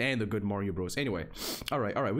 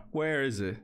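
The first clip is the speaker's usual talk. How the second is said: usual speaking voice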